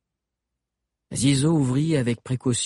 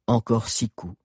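ordinary delivery

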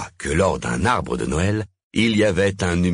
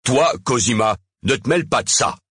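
angry, higher-pitched warning; beside himself; 7 semitones higher, 3 dB louder, faster than usual. A narrator's reading. Mayor telling Cosima to stay out, furious. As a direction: warn her off, losing your temper. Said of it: "hors de lui".

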